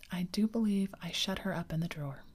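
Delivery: usual speaking voice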